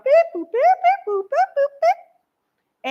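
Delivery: high robotic beep boops; scanner beeps. Pretend. Teacher imitates barcode scanner.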